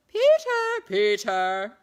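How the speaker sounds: very high, loud mocking falsetto shout; men echoing the call in falsetto. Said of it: "some in falsetto".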